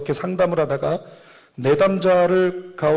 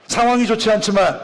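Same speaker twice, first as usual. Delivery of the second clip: higher-pitched, forceful preaching call; emphatic proclamation; 5 semitones higher, 3 dB louder than usual. Real, not acted. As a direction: proclaim it to the congregation, pitch lifted. Title proclaimed after asking congregation. (높은 음으로 힘주어 선포)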